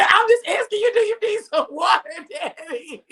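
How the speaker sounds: high, laughing through the words; laughing call. Real, not acted. To her coughing daddy in back, asking through laughter. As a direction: laugh while you call out to him.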